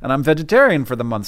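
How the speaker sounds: ordinary delivery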